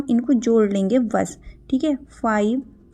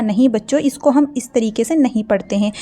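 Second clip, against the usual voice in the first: normal talking voice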